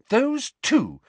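put-on high, exasperated girl; furious. A narrator's reading. Girl fuming at the twins who just left, furious. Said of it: "said Hermione furiously".

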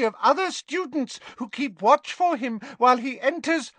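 a little elf proudly reporting; squeaky elf. A narrator's reading. Dobby reports on Malfoy.

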